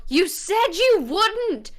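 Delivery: high, drawn-out acted protest; accusing outcry. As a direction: throw it at him as an indignant accusation. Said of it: "glare at Galen and I go".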